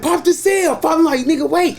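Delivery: high, urgent; excited call. Retold or imitated. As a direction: call it out eager. Excited call before fight.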